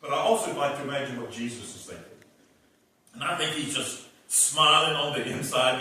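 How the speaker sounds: ordinary delivery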